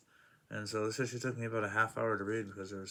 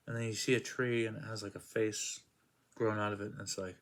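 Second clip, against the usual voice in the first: ordinary talk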